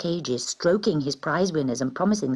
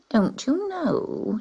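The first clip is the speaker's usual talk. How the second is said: high, slow scornful cat; 9 semitones higher, slower than usual. A narrator's reading. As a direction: talk down to the kitten. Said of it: "she said scornfully".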